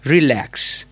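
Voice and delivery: "Relax" stressed on lax, falling at the end; stress on second syllable. Shown as a demonstration. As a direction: demonstrate "Relax" with weight on lax. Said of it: "The stress lies in the lax".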